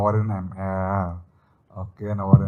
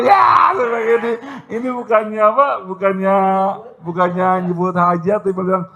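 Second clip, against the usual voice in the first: excited retelling; 10 semitones higher, 6 dB louder than usual. Real, not acted. Laughing while retelling.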